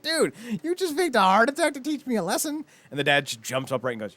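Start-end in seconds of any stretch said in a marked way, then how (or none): 0.0-2.6 high-pitched outraged protest; pissed-off son. Acted. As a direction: imitate a son indignant at his dad's trick. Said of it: "super fucking pissed 'cause he's like".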